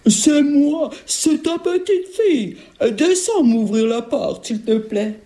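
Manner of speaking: high, slow sugary child imitation; fake little girl. Ogress tricking grandmother, fake little girl voice. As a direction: fake a small girl's voice, drawn out.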